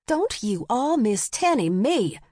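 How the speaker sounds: high, drawn-out indignant retort; slow, swooping exasperated scolding; scolding yell. A narrator's reading. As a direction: shut down a child's wheedling. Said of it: "she yelled".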